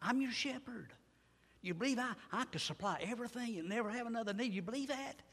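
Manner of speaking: the Lord soothing his flock; tender reassurance. Acted. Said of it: "but he says".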